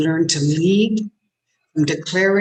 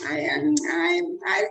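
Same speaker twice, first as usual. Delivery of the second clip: faltering stammer; 10 semitones higher than usual. Shown as a demonstration. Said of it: "See, as soon as I do that".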